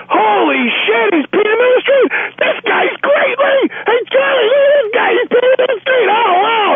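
a thrilled cop calling his partners over; delighted cop. Retold or imitated.

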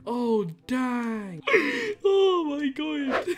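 high, drawn-out, laughing gasps; helpless laughter. Real, not acted. Troll watching the server turn on victim, cracking up. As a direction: squeal it slowly through real hysterics.

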